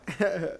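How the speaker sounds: odd high giggle; hysterical laugh. Real, not acted. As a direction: burst out laughing strangely. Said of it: "Why are you laughing like that?".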